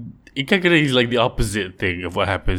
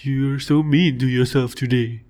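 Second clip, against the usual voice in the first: worried friends; 4 semitones higher than usual. Retold or imitated. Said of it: "And then people going".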